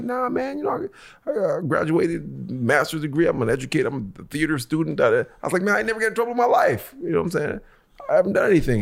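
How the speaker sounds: mock squeaky innocence, protesting to cops; arrested man insisting he's innocent to officers; high pleading.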